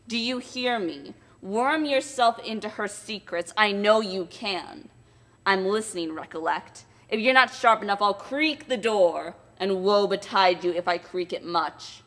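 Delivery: Quilp threatening his wife; menacing threats. Acted. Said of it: "The dwarf, muttering a terrible curse".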